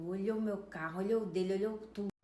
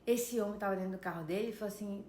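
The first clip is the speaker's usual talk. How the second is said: ordinary talk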